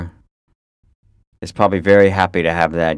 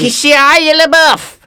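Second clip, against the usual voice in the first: very high, loud, drawn-out holler; yelling; 10 dB louder, much slower than usual. Acted. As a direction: yell the name down a busy street. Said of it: "just keep yelling".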